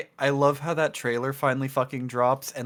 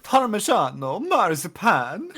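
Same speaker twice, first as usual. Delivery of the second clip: mock Italian accent; 7 semitones higher, 4 dB louder, slower than usual. Mocking Italian food names.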